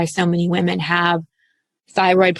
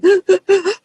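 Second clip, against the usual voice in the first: crying gasp; 12 semitones higher than usual. Shown as a demonstration. Sobbing arc: crying gasp.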